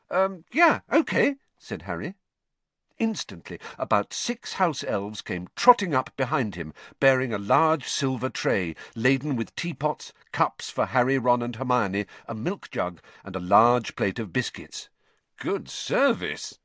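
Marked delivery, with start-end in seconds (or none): 0.1-1.3 put-on hesitant boy's answer. A narrator's reading. Harry voiced by narrator. 15.4-16.6 slow, impressed approval. A narrator's reading. Ron praising the elves' tea service, impressed and slow. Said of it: "in an impressed voice".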